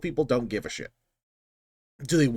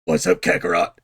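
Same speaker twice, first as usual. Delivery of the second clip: louder, put-on cocky Vegeta voice; Vegeta impression; 4 semitones higher, 6 dB louder than usual. Vegeta casually greeting Goku mid-fight, cocky impression. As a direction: greet your rival way too casually. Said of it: "Vegeta was like".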